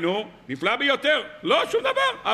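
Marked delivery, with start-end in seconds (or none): 1.4-2.3 high mocking cry with a laugh; preacher scoffing at the audience, sarcastic high cry. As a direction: laugh off their indifference. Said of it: "mocking nothing changes".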